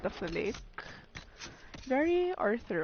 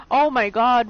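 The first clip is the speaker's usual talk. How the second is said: loud, sudden burst of surprise; startled oh my god; 10 dB louder than usual. Real, not acted. Gamer startled by something on screen, loud surprise. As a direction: blurt it out, caught off guard.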